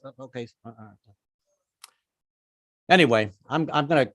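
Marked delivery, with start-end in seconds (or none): 0.0-1.1 much softer, cowed and stammering; meek mumble. Retold or imitated. Comic cowed by activists, much softer meek stammer. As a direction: shrink back and mumble. Cowed reply after scolding.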